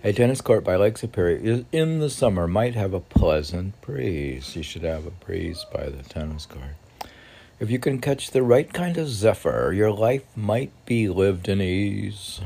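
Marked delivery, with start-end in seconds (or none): 0.0-4.6 fast loud reread. Acted. 7.6-12.4 loud poem reading. Acted. Reading poem aloud, louder.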